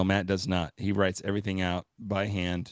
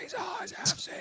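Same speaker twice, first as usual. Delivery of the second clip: spooky whisper; 6 dB quieter than usual. Pretend. Dramatic echo of "these eyes.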